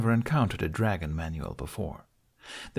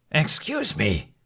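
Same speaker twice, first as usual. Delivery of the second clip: slow, indignant, higher; huffy; 8 semitones higher, slower than usual. A narrator's reading. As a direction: huff it out, affronted. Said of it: "the dragoness huffed".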